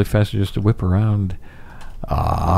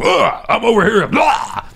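video game yell; 11 semitones higher than usual. Retold or imitated. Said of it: "where you're just screaming".

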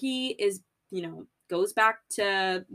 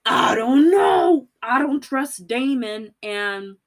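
suspicious Otto; 3 semitones higher, 7 dB louder than usual. Retold or imitated. King's hand voicing doubt, loud slow suspicion. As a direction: sound out the distrust slowly and loudly. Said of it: "um, is like".

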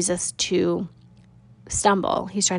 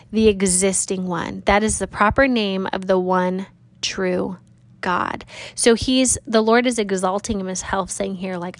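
ordinary talk

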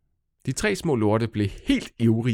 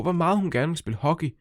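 usual speaking voice

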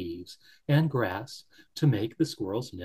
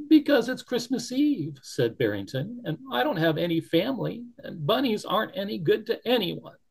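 higher, despairing bunny voice; sad little bunny; 9 semitones higher than usual. Acted. Barrington despairs alone in the snow, high and sad. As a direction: lament being useless.

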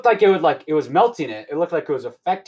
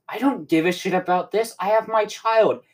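higher-pitched, insistent mock hero; Mandalorian; 6 semitones higher than usual. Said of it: "Moff Mandalorian goes".

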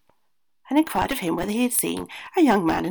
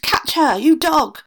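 screamed order, higher and much louder; screaming aunt; 8 semitones higher, 11 dB louder, slower than usual. A narrator's reading. Aunt screaming at her dog, loud shrill order. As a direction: yell at the dog to stop the thief. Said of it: "the aunt saw her and screamed".

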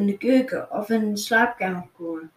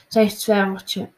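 plain reading voice

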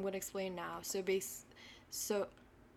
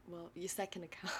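whispering; 8 dB quieter than usual. Real, not acted. Whispered correction.

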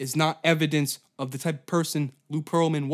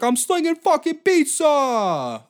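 much higher, louder, slow mock Italian accent; mock Italian cop; 13 semitones higher, 8 dB louder, slower than usual. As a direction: yell it like a fake Italian cop. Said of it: "fake Italian cops was just like".

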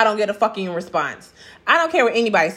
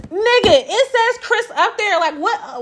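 laughing disbelief; 10 semitones higher than usual. Real, not acted. His name is plainly shown.

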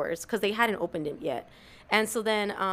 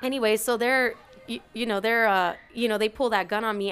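ordinary talk, nothing marked

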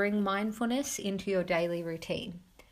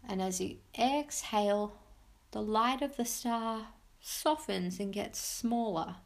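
soft spa whisper; 3 dB quieter than usual. Acted. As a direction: guide them gently into calm. Leads a guided meditation.

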